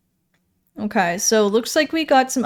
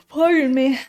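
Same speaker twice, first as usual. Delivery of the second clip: yawning; 7 semitones higher than usual. Real, not acted. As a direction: yawn mid-sentence. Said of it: "So much yawning".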